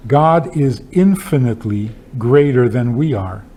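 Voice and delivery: ordinary delivery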